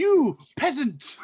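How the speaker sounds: slow, high Texan-British mock royal snarl; mock royal dog in a yeehaw British accent. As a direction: play a haughty queen in a bad Texan-British accent. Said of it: "What accent is that?".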